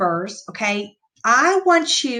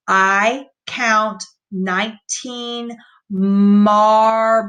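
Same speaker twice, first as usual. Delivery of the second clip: slow, word-by-word reading, "marbles" drawn out; slow modeled sentence; slow read-aloud; 4 dB louder, much slower than usual. Shown as a demonstration. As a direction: read it slowly, stretching "marbles". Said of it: "So let's read this sentence".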